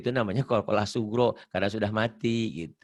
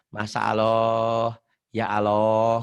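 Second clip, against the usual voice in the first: slow Sundanese-flavoured Masa Allah, ya Allah with a rounded lo; Sundanese-accented ya Allah; Garut accent; 4 semitones lower, much slower than usual. Retold or imitated. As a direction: imitate a Garut speaker saying ya Allah. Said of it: "orang Garut".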